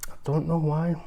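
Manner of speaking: usual speaking voice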